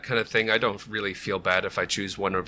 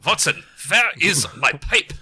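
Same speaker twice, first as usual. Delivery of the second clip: high, loud fake German accent, vee for double-u; German Sherlock Holmes; 5 semitones higher, 4 dB louder than usual. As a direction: ham up a German detective. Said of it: "The German Sherlock Holmes".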